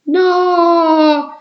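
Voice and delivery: put-on boy shouting in horror at the plan. A narrator's reading. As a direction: cry out as if stung by a scorpion. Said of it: "gridò Rick".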